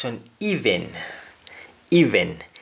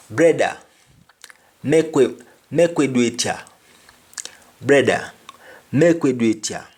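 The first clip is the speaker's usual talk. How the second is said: pitched-up patois Brother, make we do it here; Jamaican patois; 3 semitones higher than usual. Shown as a demonstration. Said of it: "Brother, let us do it here".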